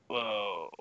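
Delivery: ordinary talk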